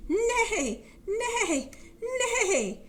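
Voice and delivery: loud, high whinnies; high-pitched horse bellow. Pretend. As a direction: neigh out three falling horse calls. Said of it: "Horse bellowed".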